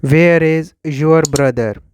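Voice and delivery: ordinary talk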